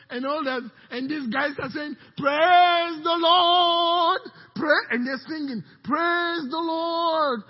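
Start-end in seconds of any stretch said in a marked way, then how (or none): 2.2-4.8 slow, loud, high praise cry; drawn-out shout. Acted. Army of singers voiced. 5.8-7.4 slow, loud, high praise cry; drawn-out shout. Acted. Singers voiced praising.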